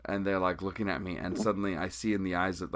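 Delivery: ordinary talk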